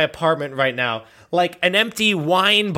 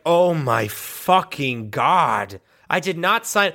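slow dismayed groan; slower than usual. Pretend. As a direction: groan it out, deflated. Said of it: "is like".

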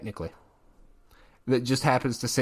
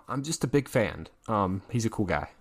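ordinary delivery

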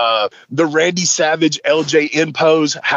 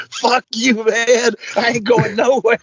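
high-pitched mock indignation; retold angry refusal; 7 semitones higher than usual. Rhino refuses James after the prank, indignant. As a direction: refuse indignantly, imitating a fed-up man.

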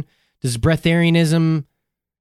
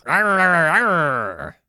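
mock Australian growl; 3 semitones higher, much slower than usual. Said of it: "when he's Australian, he peels his lips back".

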